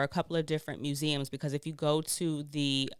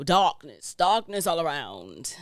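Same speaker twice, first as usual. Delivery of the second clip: slow, louder spooky storyteller; 6 dB louder than usual. Pretend. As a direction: draw it out like a ghost story. Describing scary total darkness at night.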